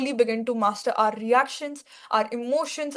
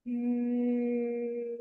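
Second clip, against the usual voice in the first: bee voice; 8 dB quieter than usual. Shown as a demonstration. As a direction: hum softly on the exhale like a bee. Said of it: "you make a buzzing sound like a bee".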